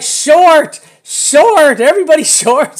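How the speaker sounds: high, loud laughing shout; yelling. Retold or imitated. Trader yelling at his group to go short. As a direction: bellow the order, cracking up at the end. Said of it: "so I'm like yelling".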